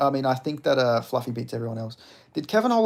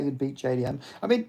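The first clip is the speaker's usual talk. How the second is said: ordinary talk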